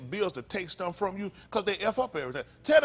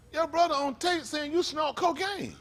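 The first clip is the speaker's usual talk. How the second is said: higher-pitched, slow, pointed accusing voice; mock FBI accusation; 8 semitones higher, slower than usual. FBI agents telling Tyson about the tape, slow high accusing voice. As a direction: spell it out slowly like an agent confronting him.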